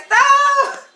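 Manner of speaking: high, loud cry to halt; reenacted shout. Woman retells telling the angry driver to stop, high mock shout. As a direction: yell it out, playing up the panic for the story.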